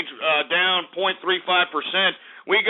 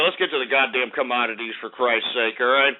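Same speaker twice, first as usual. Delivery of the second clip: ordinary delivery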